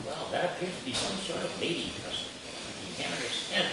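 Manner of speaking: a hint of a hushed, grave announcer; serious announcer. The public TV announcer explaining, softly mock-serious. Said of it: "the announcer is very serious".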